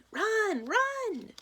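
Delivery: very high, loud yelling; frantic shout. A narrator's reading. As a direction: scream at him to run. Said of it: "Coach Marie and the other Lions shout".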